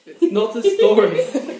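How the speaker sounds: laughing loud protest, slow and high. Real, not acted. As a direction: laugh it out in disbelief.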